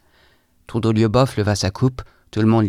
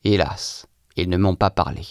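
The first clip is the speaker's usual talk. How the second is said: ordinary delivery, nothing marked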